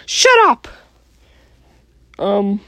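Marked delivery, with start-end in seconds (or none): 0.1-0.7 shouting. Acted. Mickey yells at Kermit.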